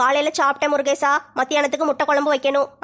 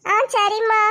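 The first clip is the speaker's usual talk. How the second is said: put-on child's reply; 7 semitones higher, much slower than usual. Child answers mother.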